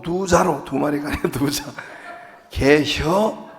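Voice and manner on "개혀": 개혀 said short and quick, Chungcheong style; 충청도 사투리로 빠르게. Shown as a demonstration. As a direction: snap out 개혀 in two syllables. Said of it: "충청도는 딱 두 마리로".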